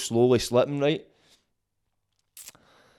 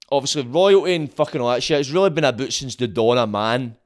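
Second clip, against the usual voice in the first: ordinary talk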